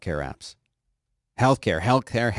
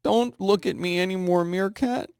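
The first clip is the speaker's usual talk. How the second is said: higher pleading voice; mock pleading; 8 semitones higher, slower than usual. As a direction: beg it to leave, hammed-up. Pleading with Meerkat app.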